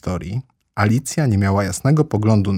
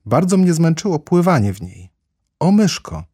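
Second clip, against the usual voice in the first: put-on higher, imploring child-heroine voice; earnest appeal; 8 semitones higher than usual. A narrator's reading. Alice asks the mouse for help, pleading. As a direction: plead with a small creature.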